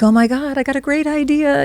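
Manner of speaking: high-pitched retold excitement; lifted, eager self-quote; excited realization. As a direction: gush over a brand-new idea. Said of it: "had my first really good idea".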